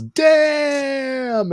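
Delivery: high, drawn-out mock yell; hammed-up anguished holler, not at full volume; imitated movie yell. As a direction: hold the word long and high like a rooftop cry. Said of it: "stands on the top of a roof and yells".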